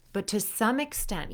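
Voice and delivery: ordinary delivery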